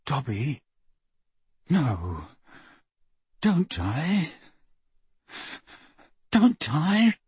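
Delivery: hushed, halting begging with long pauses; grieving plea. A narrator's reading. Harry to dying Dobby on the grass, pleading.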